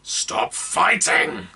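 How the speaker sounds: high, slow, gruff snapped order. Acted. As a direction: snap at him to hold still. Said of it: "the gargoyle snapped".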